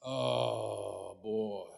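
low, slow, drawn-out "uh-oh" groan of words; dismayed. Retold or imitated. Pastor realizing he stepped in it, dismayed. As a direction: sink into soft, stretched-out dismay. Realizes he has said wrong thing.

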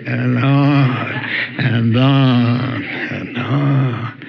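slow, drawn-out and on and on; droning, sleepy drawl; dragging on. Shown as a demonstration. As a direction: stretch each and on like a droning sermon.

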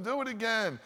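put-on high, eager child begging; excited kid. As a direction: beg like a kid in the pool.

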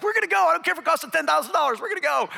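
gleefully insisting they're going no matter the cost; giddy retold declaration to his wife; excited insistence. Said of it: "I was so excited".

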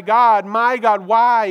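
loud, drawn-out imitated cry; crying out to God. Preacher voices Jesus calling out to God, loud pleading cry. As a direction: call out in anguished appeal, stretching each word. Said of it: "It's, it's a cry".

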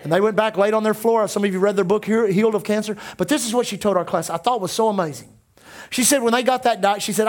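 ordinary talk

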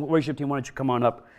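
usual speaking voice

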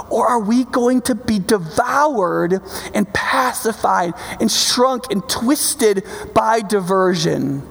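high, urgent preacher's cadence; impassioned preaching. Real, not acted. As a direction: press each phrase home from the pulpit. Preaches an urgent either-or climax.

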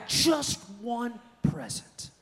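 put-on high, drawn-out kid's plea; begging. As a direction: act out a child pleading with dad. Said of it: "I would beg my dad".

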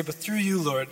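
ordinary talk, nothing marked

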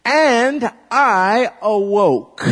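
loud, slow and high; proclaiming the line slowly; slow recitation. Shown as a demonstration. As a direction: call it out slowly. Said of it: "And I say".

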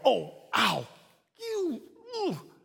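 creaky groans waking up, ending in a high squeak; old man groans. Retold or imitated. Preacher mimics waking up stiff, aching groans. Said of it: "I'm an old man of God".

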